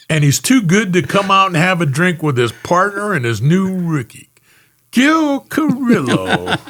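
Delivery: hammed-up drunk, laughing; drunk partner. Drunk partner needling him.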